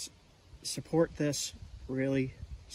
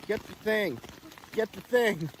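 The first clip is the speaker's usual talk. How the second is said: higher, louder reenacted yell; retold yelling; 9 semitones higher, 5 dB louder, slower than usual. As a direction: yell an urgent order, imitating last night. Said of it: "started yelling to Leah".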